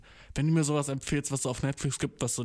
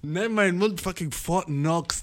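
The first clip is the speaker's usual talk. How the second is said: slow, higher bragging swagger; swaggering boast; 4 semitones higher, 4 dB louder than usual. Acted. Bragging to a friend about his mouth, slow swagger. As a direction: boast like a rapper flexing.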